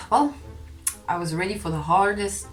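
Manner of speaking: usual speaking voice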